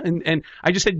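ordinary delivery

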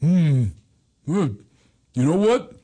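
slow, halting hums and pronouncements; father impression. Daughter imitating her father hatching a shark plan, father impression. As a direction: chew over each phrase, long gaps between. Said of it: "That's how he talks".

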